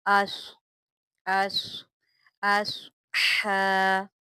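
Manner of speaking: अस with a hissing, heavy sad; whistling heavy sad. Shown as a demonstration. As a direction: whistle the s in अस and keep it thick. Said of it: "सीटी के साथ पढ़ना है और सौद को मोटा करके".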